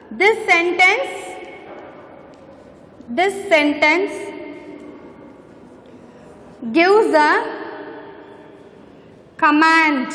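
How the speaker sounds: slow dictation. Shown as a demonstration. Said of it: "Next, come here".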